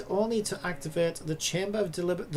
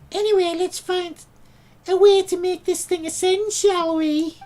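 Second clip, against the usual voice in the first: high, loud Brummie Paimon voice; squeaky Birmingham girl, chirpy; Birmingham accent; 13 semitones higher, 8 dB louder than usual. Acted. Streamer voicing Paimon as a Brummie, high Birmingham accent. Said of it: "That would be the perfect voice for Paimon, as if she was from Birmingham".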